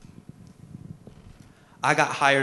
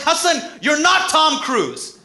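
much higher and louder, dragged slowly; hammed-up angry dad; 12 semitones higher, 7 dB louder, much slower than usual. Dad shouting down his son's comedy dream, exaggerated yell. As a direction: play the exasperated immigrant father, big and slow. Dad's punchline in shouting fight.

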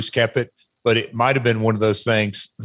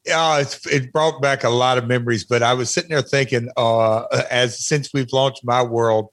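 ordinary delivery

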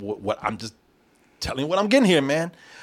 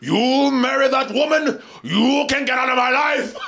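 mock Shakespearean actor; 7 semitones higher than usual. Imitating an actor banishing someone from his life, mock-Shakespearean shout. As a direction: thunder it like a hammed-up stage tragedian. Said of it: "He came here doing Shakespeare".

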